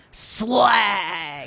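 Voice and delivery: drawn-out band-name shout. Shown as a demonstration. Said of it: "you gotta say it like that".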